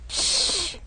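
hissing snorkel breath; Darth Vader breathing. Acted. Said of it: "breathing sound just like Darth Vader's".

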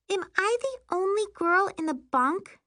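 put-on very high child's voice; high-pitched little-girl question; little girl asking. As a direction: ask as a small, uncertain kid. Small child questions the counselor.

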